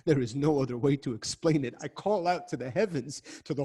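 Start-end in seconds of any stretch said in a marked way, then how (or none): none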